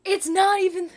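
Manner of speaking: high, very loud shriek of surprise; loud high-pitched disbelief; astonished relief. Real, not acted. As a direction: shriek it out, overjoyed and stunned. Relieved shock at flipped canvas.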